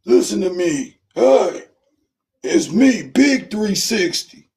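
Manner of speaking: put-on high, slurry drunk bragging. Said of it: "you ain't".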